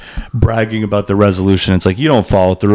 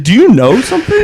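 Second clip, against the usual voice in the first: much higher, teasing Do you know something; mock ominous question; 14 semitones higher than usual. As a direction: playfully needle the stranger's mystery talk. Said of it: "It's like".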